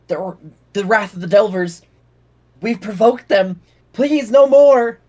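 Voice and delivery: a frightened man begging for mercy; terrified pleading. Acted. Cowering stranger warns the party, rising terrified plea.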